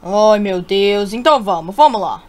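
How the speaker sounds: low, heavy resignation; weary exasperation. Acted. Rescuer leading the careless girl back, weary exasperation. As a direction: sigh it out low, giving up arguing.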